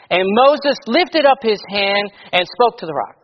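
loud, measured King James reading voice; scripture reading. Acted. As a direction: read the Bible passage out to the church with weight. Preacher reads scripture aloud.

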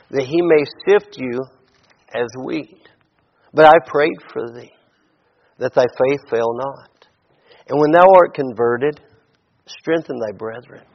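measured, reverent reading of the King James verses; scripture reading. Acted. Preacher reading Luke twenty-two to the congregation, solemn and measured. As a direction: let each old-style phrase land with pauses.

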